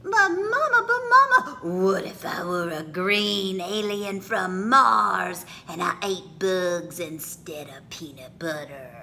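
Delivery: put-on child giggling at mama, then whispering the secret; giggly child. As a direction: tease mama with a silly question.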